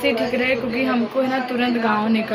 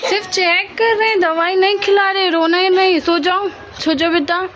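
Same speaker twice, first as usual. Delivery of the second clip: reassuring; 9 semitones higher, 6 dB louder than usual. Real, not acted. Reassuring frightened child.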